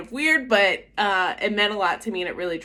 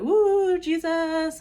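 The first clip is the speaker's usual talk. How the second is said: high, whooping mock cheer; mock Jesus high; 10 semitones higher, much slower than usual. Imitating everyone at retreat's end, high mock-elated cheer. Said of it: "everyone's on a Jesus high".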